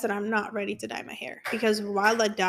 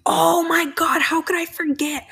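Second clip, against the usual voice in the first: higher and louder burst of realization; sudden excitement; 6 semitones higher, 6 dB louder than usual. Real, not acted. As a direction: gasp it out like big news just hit you. Suddenly remembering big news.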